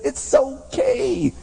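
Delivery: ordinary talk, nothing marked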